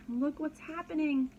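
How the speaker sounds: ordinary delivery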